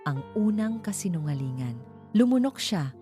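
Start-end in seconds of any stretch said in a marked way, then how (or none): none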